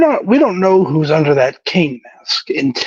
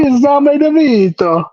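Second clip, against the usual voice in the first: put-on hammy Italian accent, pitched up; over-the-top Italian accent; mock Italian accent; 8 semitones higher than usual. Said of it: "Italian accent".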